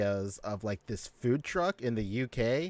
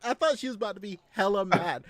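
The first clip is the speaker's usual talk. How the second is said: usual speaking voice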